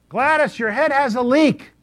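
shouting close to a deaf woman's ear, high and loud; loud into her ear. Retold or imitated. Pastor to deaf old Gladys, loud and high. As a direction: call it right up by her head so she hears. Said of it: "I'd have to read it like this".

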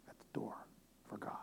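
soft whispered phrase. Real, not acted. Preacher whispering about waiting at the door for God. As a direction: drop to a whisper on it.

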